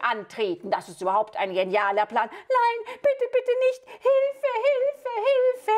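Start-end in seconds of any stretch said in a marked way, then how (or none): 2.3-5.7 princess screaming for help. Acted. Princess crying for help as the wizard turns her into a crocodile, shrieked high. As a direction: shriek for help as the spell hits.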